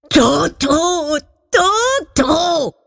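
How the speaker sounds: high, drawn-out cry of despair; high wail, each word falling away. A narrator's reading. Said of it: "Dans son désespoir, il cria".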